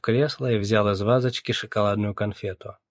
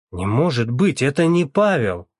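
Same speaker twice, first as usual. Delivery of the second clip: raised, louder, disbelieving protest; alarmed denial; 5 semitones higher, 6 dB louder than usual. A narrator's reading. Mother defending her son to the captain, alarmed. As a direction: protest in alarm, rising to a loud high finish.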